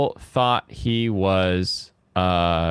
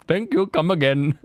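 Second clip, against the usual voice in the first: put-on Indian accent; 4 semitones higher than usual. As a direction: play a shopkeeper with a mock Indian accent. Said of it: "He has the worst Indian accent".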